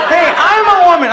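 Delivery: high, loud protesting shout; offended girlfriend. Acted. Girlfriend objecting to the comic's remark, offended shout.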